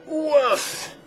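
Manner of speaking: high, sudden woof of effort; startled-sounding high exclamation. Real, not acted. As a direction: let out an abrupt high whoof. Sudden noise breaks off instruction.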